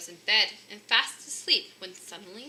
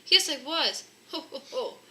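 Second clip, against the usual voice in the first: louder, laughing confession; jolly laugh; 6 semitones higher, 5 dB louder than usual. Acted. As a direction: chuckle it out like Santa. Santa admits laughing.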